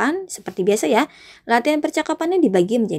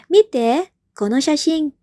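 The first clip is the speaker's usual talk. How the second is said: raised, eager look at this; teacher voicing speaker A excitedly; character A; 6 semitones higher, slower than usual. Shown as a demonstration. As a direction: call a friend over to a photo. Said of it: "si A mengatakan".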